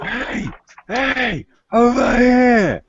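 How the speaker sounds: high-pitched call across a distance, drawn out; acted yell. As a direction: yell to draw attention, voice leaping way up. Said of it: "and yells out".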